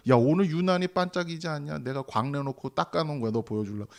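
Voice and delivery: soft, low imitation of an unruffled God; casual, calm aside. God casually, calmly showing Abraham stars.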